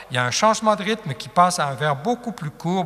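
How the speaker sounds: usual speaking voice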